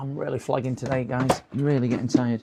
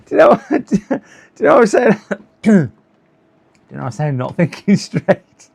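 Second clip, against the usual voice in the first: fast, high and loud, breaking into laughter; real laughter over his own muddle; laughing; 7 semitones higher, 10 dB louder than usual. Real, not acted. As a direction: laugh it off, flustered. Laughing at himself, flustered.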